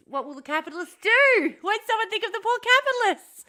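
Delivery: sarcastic plea for the rich; mock pity. Mocking pity for capitalists.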